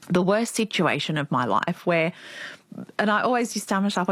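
ordinary delivery

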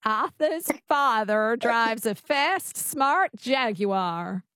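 slow, drawn-out transatlantic accent; transatlantic twang attempt. Shown as a demonstration. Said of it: "go back to the sentence".

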